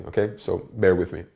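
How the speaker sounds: ordinary talk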